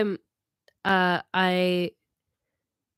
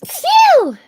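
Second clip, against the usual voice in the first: sudden loud high sneeze; real sneeze; 15 dB louder than usual. Real, not acted. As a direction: let out a big sneeze. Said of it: "my allergies are getting to me".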